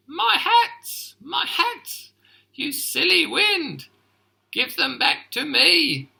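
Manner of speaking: high, loud angry yelling; furious shout. Acted. Missus McPhee yells at the wind, furious. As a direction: scream at the wind for the hats. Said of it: "Missus McPhee was furious. She shouted".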